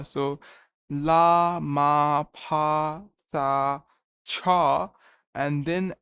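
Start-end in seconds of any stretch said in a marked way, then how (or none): 0.9-4.9 each syllable called out louder, one by one; loud syllable drill. Shown as a demonstration. Learner drilling new script syllables alone, loud recitation.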